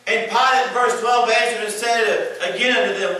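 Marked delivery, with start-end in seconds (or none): none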